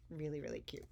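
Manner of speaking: hushed delighted aside; whispered. Real, not acted. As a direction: whisper it like a secret treat. Whispered aside about the book.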